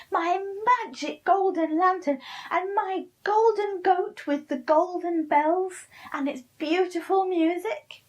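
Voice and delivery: high, loud, furious witch voice; angry witch. Acted. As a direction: scold him like a cackling witch. Witch scolding Pinkle.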